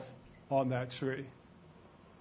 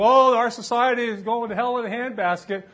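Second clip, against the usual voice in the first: louder, higher imitation of complaining; mock lament; 6 semitones higher, 7 dB louder than usual. Preacher imitating people who blame society, mock lament. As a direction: act out a hand-wringing complainer.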